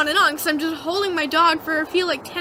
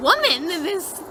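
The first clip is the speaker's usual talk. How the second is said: ordinary talk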